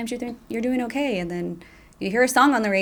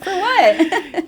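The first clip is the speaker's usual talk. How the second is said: laughing retort; 10 semitones higher, 9 dB louder than usual. Retold or imitated. Laughing retort about always apologizing.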